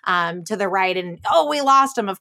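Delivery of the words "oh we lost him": louder, higher pretend triumph; lightly acted excited exclamation; mock relief. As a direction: play at having shaken a tail. Acting out pretend chase escape.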